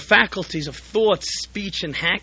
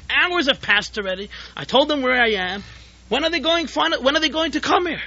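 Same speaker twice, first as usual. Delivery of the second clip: higher, rising worried pleading; a worried host frets, voice climbing, faster at the end; anxious host; 7 semitones higher than usual. Acted. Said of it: "Growing anxious".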